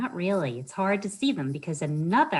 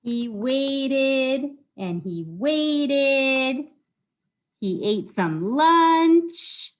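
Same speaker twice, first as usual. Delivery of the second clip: very slow, high and louder, stretching each word; drawn-out waiting; 8 semitones higher, 6 dB louder, much slower than usual. A narrator's reading. Librarian to children, hamming up the boy's long wait, very slow and high. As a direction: stretch it out like the wait lasts forever. Boy waiting all day.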